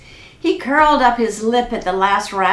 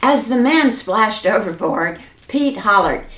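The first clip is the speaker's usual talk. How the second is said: ordinary delivery, nothing marked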